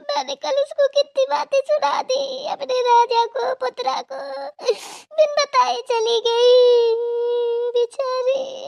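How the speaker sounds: high, sing-song sobbing lament; keen over the daughter, half singing; tearful mother's lament; crying lament. Acted. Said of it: "आपको रोना नहीं है बिल्कुल".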